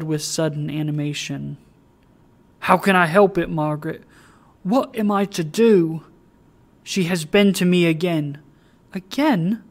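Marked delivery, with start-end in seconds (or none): 2.6-8.4 distressed plea. Acted. Richard distressed, pleading to Meg. 8.9-9.6 high, louder startled echo; startled cry. Acted. Meg crying out to Richard in alarmed surprise. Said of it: "cried Meg".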